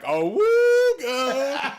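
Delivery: slow, high, loud cartoon wolf call; Adam Carolla awooga. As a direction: stretch it out like a cartoon horn. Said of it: "Adam Carolla's like".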